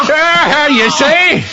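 high, laughing crow of triumph; gloating laugh. Acted. Marvel crows over the Invisible Man in the nettles, gloating laugh. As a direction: laugh it out at a beaten foe.

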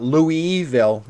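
Louisville said as "LOO-ee-vil"; Louisville as "Louie-ville". Shown as a demonstration. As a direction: silent s, clear ee in Louisville. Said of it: "It's not Louisville".

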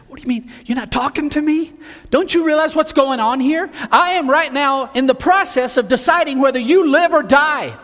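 high, rising to loud, frantic pleading; a ruler losing control, demanding an answer; desperate Pilate. Acted. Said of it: "Pilate is desperate".

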